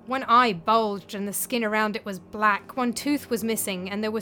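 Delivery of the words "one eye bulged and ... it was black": ordinary delivery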